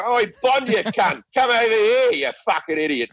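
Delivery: rough Australian heckle in place of menace; broad Australian accent. Acted. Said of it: "my accent".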